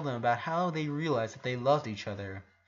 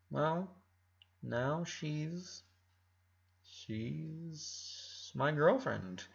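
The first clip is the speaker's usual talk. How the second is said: softer, slow and bashful; shy confession; 2 semitones higher, 8 dB quieter, much slower than usual. A narrator's reading. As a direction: admit it timidly, almost under your breath.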